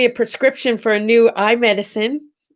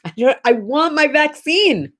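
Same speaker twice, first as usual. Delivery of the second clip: higher-pitched playful demand; mock tantrum; 6 semitones higher than usual. Streamer complains to viewers about failed vaccine booking, mock tantrum. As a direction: make a mock fuss of wanting it.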